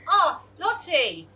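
a hint of hearty Mister Parfit calling out; slow loud hello over the fence; neighbour's greeting. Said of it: "Mister Parfit greeted her".

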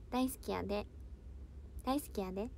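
lightly put-on Kansai dialect, softly; playful Kansai-accent practice, softly; mock Kansai. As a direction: show off a freshly learned Kansai accent, half joking. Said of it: "関西弁はマスターしました".

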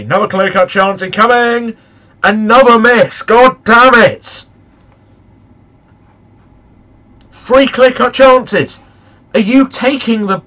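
real loud frustration at the screen; furious shout. Real, not acted. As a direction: explode at another miss. Striker misses again.

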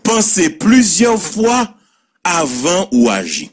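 slow, high emphatic proverb start; preacher-like maxim. Shown as a demonstration. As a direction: lay the saying down slowly. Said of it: "Proverbe créole".

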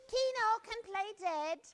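high, loud proud announcement; excited. Acted. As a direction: show off the trick. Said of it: "cried Danny".

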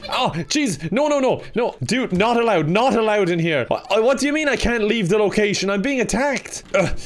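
flustered yelps as he's attacked; panicked protest. Real, not acted. Guard being attacked by a prisoner, panicked protest.

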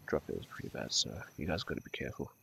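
hushed, breathy whisper warning; whispered aside. Real, not acted. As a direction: drop to a whisper. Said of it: "but drop, drop airs are pretty bad".

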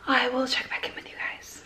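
soft unvoiced whisper to camera. Real, not acted. Vlogger signing off to viewers, whispered. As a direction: drop to a hushed whisper. Signing off until car arrives.

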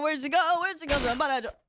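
normal talking voice

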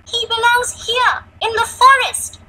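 put-on high, loud, defiant little girl; bold shout. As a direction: stand your ground in a child's shout. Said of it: "she shouted boldly".

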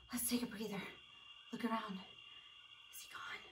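hushed, secretive whisper; very soft, wary whispering; pretend hiding whisper. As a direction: whisper as if the dinosaur might hear. Hiding from T-Rex in tree.

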